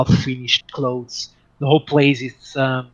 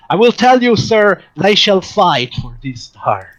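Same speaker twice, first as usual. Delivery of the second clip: louder, theatrical vow; raised, emphatic pledge to a gentleman; grandiose promoter; 6 semitones higher, 6 dB louder than usual. Acted. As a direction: declare it like a showman. Harris vows they will fight for Shisa.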